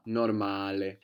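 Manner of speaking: "normale" with a long stressed "ma"; Italian "normale", stressed on the A; stress on second-last syllable. Shown as a demonstration. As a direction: lean on the "ma" in "normale". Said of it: "accent on the second last syllable".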